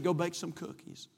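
soft, unruffled pastor after her outburst; calm deadpan advice. Retold or imitated. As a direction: drop it quietly and simply. Pastor repeats his calm advice.

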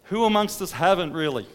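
loud, rising preacher's cry; impassioned preaching. Acted. Preacher describing the tax collector's repentance, fervent and loud. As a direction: build the fervour line by line.

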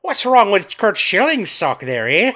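high mock Irish commentator. Host continuing the Irish announcer bit, mock Irish. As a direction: ask it in a hammed-up Irish lilt. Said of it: "with an Irish accent".

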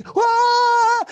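long, very high, loud scream; joyful scream. Acted. Wife sees the millions in the account, screaming. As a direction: scream out one sustained high note. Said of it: "She starts screaming".

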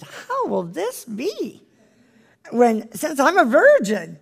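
put-on high, slow, wondering young woman; Mary's wondering question. The angel tells Mary, she asks in high wonder. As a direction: ask it in awe and disbelief. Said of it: "And Mary said".

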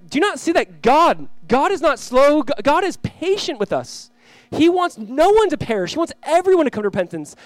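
high, emphatic exhortation; preacher voicing an insistent apostle; impassioned plea. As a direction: plead with them to finally see it. Acts Peter pleading with doubters.

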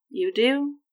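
unconvinced. A narrator's reading. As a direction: ask it with mild disbelief. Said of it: "not convinced".

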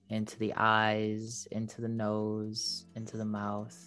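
slow, fading soft body scan; airy breathy mystical healer. Acted. Leads a meditation.

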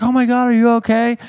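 high worried lady voice calling from a car; mock concerned lady. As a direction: play a startled driver asking if he's okay. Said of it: "She's like".

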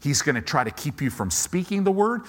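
ordinary delivery

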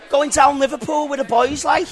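high, lilting Liverpool talk; scratchy Scouse accent. Shown as a demonstration. Pub owner demonstrating Liverpool talk, Scouse accent. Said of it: "you kinda have the scratch".

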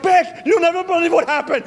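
high excited announcement; excited telling. Retold or imitated. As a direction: blurt it out to your wife. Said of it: "And I'll be like".

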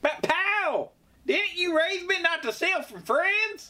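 put-on very high whiny boy; whiny kid voice. Skit child voice.

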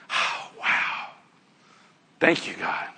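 breathless, whispered thanks after the ordeal; hushed grateful prayer; relieved whisper. Acted. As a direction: whisper it as if you just made it through. Imagined relief after getting through.

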